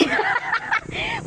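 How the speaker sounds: high squealing laugh; sudden delighted shriek of laughter; burst of laughter. Real, not acted. Someone nearly trips. (matinis na tawa)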